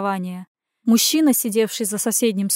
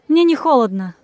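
louder, higher brush-off; lightly dismissive denial; 4 semitones higher, 6 dB louder than usual. A narrator's reading. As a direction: wave off the concern. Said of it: "отмахнулась я".

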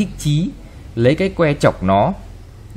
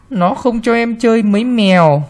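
put-on a whiny little boy, pitched higher; tattling child; 8 semitones higher, 3 dB louder than usual. A narrator's reading. Spoiled boy telling tales to his mother, higher whine. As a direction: tattle to mommy. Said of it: "mách mẹ".

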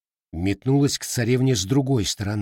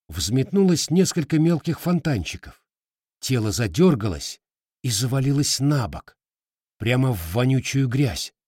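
ordinary delivery

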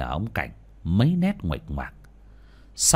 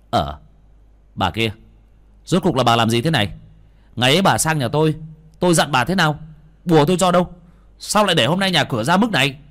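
loud, rising, higher scolding demand; angry scolding; 5 semitones higher, 6 dB louder, faster than usual. Acted. Exorcist confronting the woman who ignored him, furious. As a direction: tear into her for ignoring your warning.

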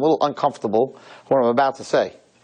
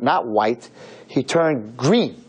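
ordinary talk

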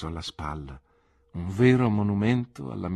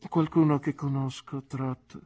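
much higher, arch aunt voice; an old aunt gently mocking; skeptical aunt; 7 semitones higher than usual. Aunt questions nephew about his sweetheart, arch aunt voice.

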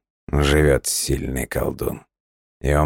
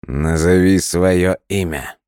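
commanding; 5 semitones higher, 6 dB louder than usual. A narrator's reading. Stranger commands by spell.

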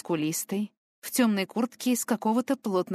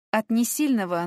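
usual speaking voice

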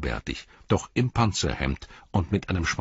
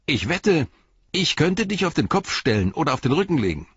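high, cocky and louder; brisk boyish challenge; 11 semitones higher, 5 dB louder than usual. A narrator's reading. Boy dares the hobbit to a wrestle, brisk and high. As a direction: dare him with a boy's swagger. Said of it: "fügte er forsch hinzu".

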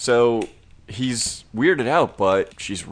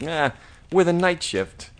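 sassy waitress; 9 semitones higher than usual. Retold or imitated. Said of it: "the sassy waitress is like".

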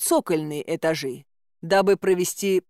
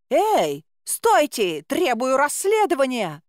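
calling after them; 6 semitones higher, slower than usual. Acted. Heroine to departing guards, shouting after them to stop. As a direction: yell down the corridor. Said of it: "крикнула вслед".

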